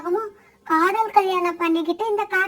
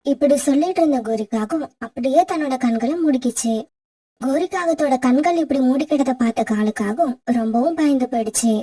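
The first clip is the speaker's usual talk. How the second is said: low, even storyteller's voice; story narration; 7 semitones lower than usual. A narrator's reading. Narrator telling listeners how the crow wept, low storyteller read. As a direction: narrate the sad fable steadily for listeners.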